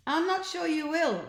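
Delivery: high, lightly voiced cocky boy; cheeky boy. A narrator's reading. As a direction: tease the headmaster coolly. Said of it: "said the boy".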